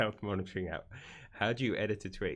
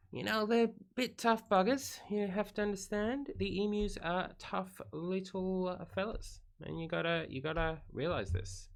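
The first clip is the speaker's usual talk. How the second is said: high-pitched mock-serious voice defending the emus; put-on comic explainer voice; 11 semitones higher than usual. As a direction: plead the emus' case in a high, silly voice.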